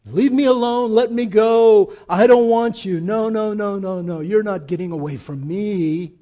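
loud pleading escalation; resisting sinner then loving God. Retold or imitated. Preacher voicing sinner fighting God, loudly protesting. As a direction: shout leave me alone, then soften. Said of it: "kissed you and said".

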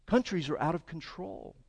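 ordinary talk, nothing marked

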